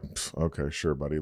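low, quiet mock agreement; dismissive humouring. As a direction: say it under your breath, unconvinced. Humouring a cheating golfer.

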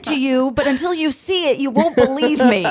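a hint of a lofty child philosopher; mock toddler philosopher. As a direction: play the baby sage explaining the cave. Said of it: "He's like".

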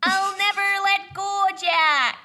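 Rose quote. Acted.

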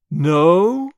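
loud, surprised cry. Acted. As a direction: throw the word out loud. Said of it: "he cried".

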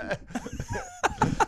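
laughing at being swindled; high laugh. Real, not acted. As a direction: burst into a high helpless laugh. Caught out by the trick.

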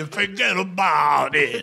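very slow, drawn-out gangster catchphrase; mocking slow wiseguy drawl. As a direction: drag it out like a movie mobster. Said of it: "established a way of speaking".

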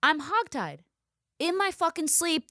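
ordinary delivery

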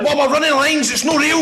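laughing explanation. Retold or imitated.

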